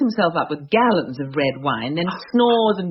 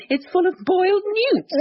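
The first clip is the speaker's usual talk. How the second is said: disgusted mock horror. Wife mocking rustic hosts to friends, high squeal of mock disgust.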